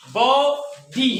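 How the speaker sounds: बो, pause, डी; teacher's split sounding-out; syllable by syllable. Shown as a demonstration. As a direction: give बो and डी a separate beat each. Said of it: "दूसरा syllable".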